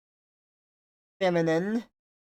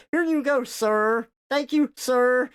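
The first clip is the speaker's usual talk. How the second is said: higher, louder polite cashier voice; overcorrecting cashier; 7 semitones higher, 4 dB louder than usual. Retold or imitated. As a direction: hand over the order too politely. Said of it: "They're like".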